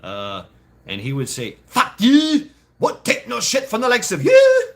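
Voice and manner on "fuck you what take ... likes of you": high, loud Welsh drill sergeant yelling; imitating a furious Welsh sergeant; Welsh sergeant shout. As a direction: bellow at a recruit in a Welsh accent. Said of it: "he was Welsh".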